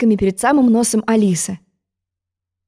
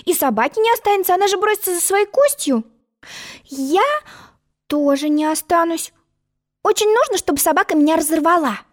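put-on high, earnest girlish voice; young girl voice; 9 semitones higher than usual. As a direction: think aloud as a young girl. Alice's reasoning voice.

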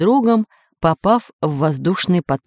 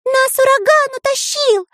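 very high squealing cry; shouting. A narrator's reading. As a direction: shriek back over the wind. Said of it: "кричал ему в ответ Карандаш".

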